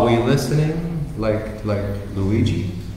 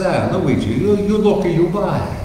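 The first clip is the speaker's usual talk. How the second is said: put-on higher, broken-English Italian accent; a farmer waving him in, Italian accent; 5 semitones higher than usual. Vincenzo invites Luigi to look at the cows, Italian accent. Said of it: "an Italian couple in southern Italy".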